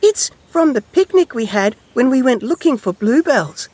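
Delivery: high, loud, tiny mouse voice; little mouse. A narrator's reading. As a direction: chirp out a memory like a mouse. Mouse explains the find.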